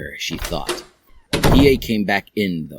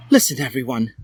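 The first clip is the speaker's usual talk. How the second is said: Draco voice; 9 semitones higher, faster than usual. A narrator's reading. Draco addressing all.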